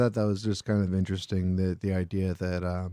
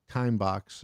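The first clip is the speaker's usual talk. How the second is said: usual speaking voice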